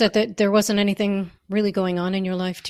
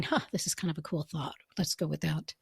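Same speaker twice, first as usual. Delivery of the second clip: musing to oneself; 5 semitones lower, 11 dB quieter than usual. Retold or imitated. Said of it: "or was it just a genuine".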